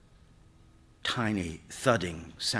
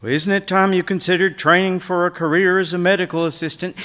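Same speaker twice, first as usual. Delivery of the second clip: loud, high, quick ad pitch; peppy, pushy commercial read, pitched up; 8 semitones higher, 11 dB louder, faster than usual. A narrator's reading. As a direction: sell it like a television commercial announcer. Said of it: "voice of a television commercial".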